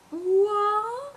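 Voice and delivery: put-on high baby vocalizing; imitated baby coo. Mom imitating her baby make a sound, high coo. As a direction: answer with a high infant-like coo. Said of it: "and then she'll be like".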